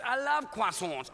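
croissants said kwa-sahn, French style, no r; mock French croissants. Shown as a demonstration. Pastor jokingly saying croissants the French way. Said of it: "I say it like that 'cause I'm French".

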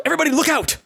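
high-pitched shouted warning. Acted. Player's character warns the party of a falling rock, shouting. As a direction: shout it out to alert everybody. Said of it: "And I shout".

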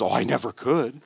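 put-on meek, self-effacing voice; imitated humble reluctance, voice held back; mock humility. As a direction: act out false modesty, demurring piously. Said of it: "False humility says".

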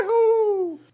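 loud, high signal call; hooting holler. Shown as a demonstration. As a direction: hoot it out long and high. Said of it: "hooting and hollering".

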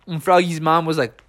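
ordinary delivery

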